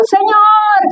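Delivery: imitated cry to God, high and drawn out; grito de clamor. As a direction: mimic a sudden, high-pitched wail of prayer. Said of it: "de repente oigo el grito". (grito de clamor imitado, agudo y sostenido)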